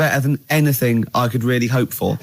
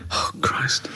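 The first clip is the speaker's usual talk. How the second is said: whispered exasperation; 7 dB quieter than usual. Real, not acted. Muttered despair at Carl's answer.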